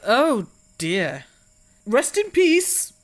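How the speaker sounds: ordinary delivery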